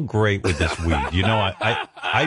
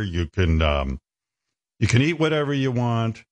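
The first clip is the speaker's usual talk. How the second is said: ordinary delivery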